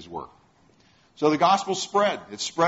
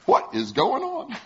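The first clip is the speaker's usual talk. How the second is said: higher, slow, puzzled question; bewildered; 8 semitones higher, slower than usual. Retold or imitated. Peter alone in the street after the angel vanishes, slow and bewildered. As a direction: drag it out in disbelief.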